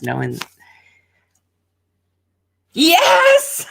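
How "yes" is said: sudden high shout of triumph; excited shout. Real, not acted. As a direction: yell it like you just hit the jackpot. Tarot card answer revealed.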